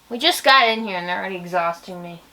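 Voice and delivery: usual speaking voice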